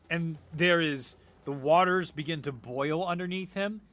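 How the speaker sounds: ordinary delivery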